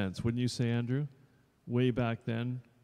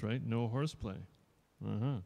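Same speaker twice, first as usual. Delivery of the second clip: ordinary talk, nothing marked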